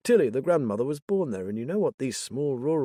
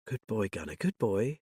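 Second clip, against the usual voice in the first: soft, gentle praise; soothing; 5 dB quieter than usual. Acted. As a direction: praise the dog quietly so it stays calm. Soothing the frightened dog.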